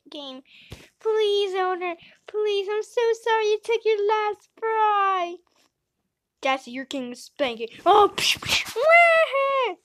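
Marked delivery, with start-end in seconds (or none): 1.0-5.4 high, loud pleading whine; begging. Acted. Begging owner for fries. 7.8-9.7 high, loud whining wail; whiny cry. Acted. Whiny outburst.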